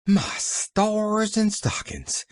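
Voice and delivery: high, slow gasp of shock; a startled maid's slow high exclamation. A narrator's reading. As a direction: gasp it out, aghast. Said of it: "gasped Nancy".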